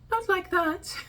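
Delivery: squeakily high, joking overshoot; exaggerated high voice. Shown as a demonstration. Creator joking about a nervous voice, exaggerated very high pitch. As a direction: jump far above your normal pitch for a laugh. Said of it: "gets slightly higher".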